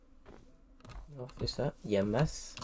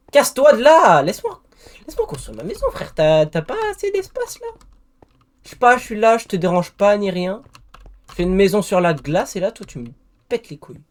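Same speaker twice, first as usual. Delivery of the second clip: angry yelling; 8 semitones higher, 8 dB louder than usual. Real, not acted. Yelling at mob blocking house.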